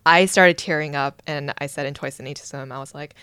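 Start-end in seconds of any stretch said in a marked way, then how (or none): none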